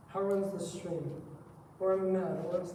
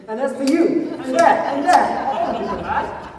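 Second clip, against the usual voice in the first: fighting shouts; 7 semitones higher, 14 dB louder, much slower than usual. Acted. Fight, striking back at attacker.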